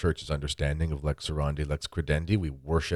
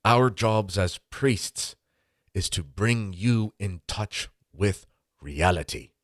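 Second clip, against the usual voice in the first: drawn-out Irish sermon ending; Irish priest impression; 3 semitones higher, much slower than usual. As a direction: close the sermon slowly in an old priest's Irish accent. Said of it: "I'll do my best Irish impression".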